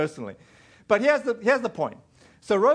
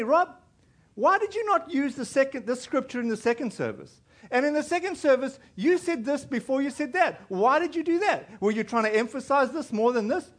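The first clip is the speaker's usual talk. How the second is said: higher-pitched imitation of a nitpicking questioner; insistent questioning; 6 semitones higher than usual. Congregant grilling the preacher after the service, insistent. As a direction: press the questions, voice raised in pitch.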